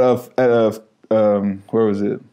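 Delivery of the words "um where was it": ordinary talk, nothing marked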